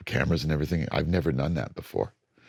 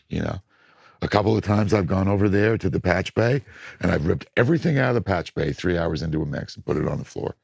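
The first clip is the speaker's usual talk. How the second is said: ordinary talk, nothing marked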